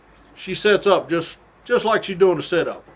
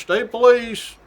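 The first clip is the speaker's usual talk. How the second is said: high, loud call up the stairs; announcing shout; 7 semitones higher, 5 dB louder, slower than usual. Retold or imitated. Trooper going up stairs, shouting to announce himself. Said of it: "we keep announcing".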